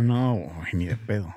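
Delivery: a quick secret refusal in a whisper. Real, not acted. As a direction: keep it under your breath. Whispered reply during a game.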